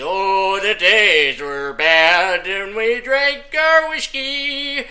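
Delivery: put-on slow, mournful Irish-accented telling; hammed-up Irish sob story, slow; mock Irish lament. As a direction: drag it out like a sad Irish ballad. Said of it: "I'm talking about the sad, crappy".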